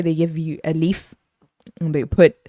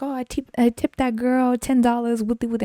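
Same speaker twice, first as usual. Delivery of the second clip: higher put-on boastful voice; mocking customer imitation; 6 semitones higher than usual. Customer boasting about her tip, mocking. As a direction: parody a self-important complainer.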